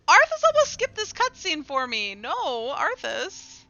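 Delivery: loud, high cry of protest; real exclamation at a misbehaving pet; alarmed scolding. Real, not acted. Player scolds Arthas for nearly skipping the cutscene, alarmed shout.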